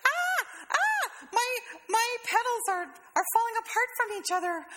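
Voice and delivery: very high, squeaky mock panic; hammed-up tiny bud in distress; panicking flower bud. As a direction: squeal as if coming apart. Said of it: "It's like".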